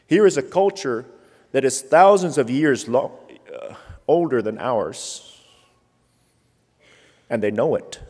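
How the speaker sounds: loud preaching build; roaring rallying speech. Real, not acted. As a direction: drive it home to the congregation.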